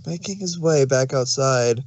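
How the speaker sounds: ordinary delivery, nothing marked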